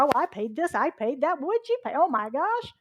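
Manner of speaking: loud, high back-and-forth griping; mock complaining. People trading gripes about gas prices, mock complaining. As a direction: pile on petty complaints, rising to mock outrage. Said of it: "Someone else starts complaining".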